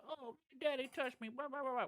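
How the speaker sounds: high mock whiny voice; high mock whine brushing off a complainer; mocking whiner. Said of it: "and you're like".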